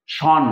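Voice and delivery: শন said together as one closed syllable; one unbroken syllable. Shown as a demonstration. Teacher shows the undividable part, শন in one piece. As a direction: run শন together in one beat. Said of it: "একসঙ্গে উচ্চারণ করতে হচ্ছে".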